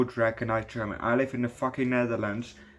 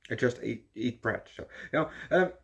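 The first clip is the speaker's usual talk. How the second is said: ordinary talk, nothing marked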